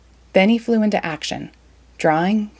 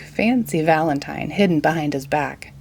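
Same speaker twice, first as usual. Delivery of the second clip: plain reading voice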